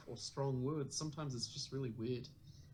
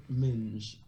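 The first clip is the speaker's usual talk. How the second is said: British accent; 5 semitones lower, 6 dB louder than usual. Shown as a demonstration. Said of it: "define the word minge".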